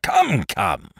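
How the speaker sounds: lightly acted impatient king. A narrator's reading. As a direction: prod him to hurry. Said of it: "said the king impatiently".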